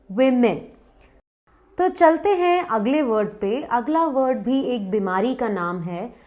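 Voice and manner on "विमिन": वुमेन as "wim-in"; short i in the first syllable of वुमेन; correct pronunciation. Shown as a demonstration. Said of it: "इसका pronunciation है". (वुमेन को "विमिन" बोलें)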